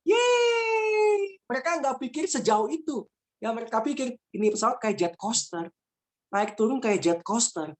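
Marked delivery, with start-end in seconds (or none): 0.0-1.4 very high, long squealed yay; child's cheer. Retold or imitated. Children who don't think of crashing, gleeful squeal. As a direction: imitate a carefree kid cheering. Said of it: "Tapi anak-anak".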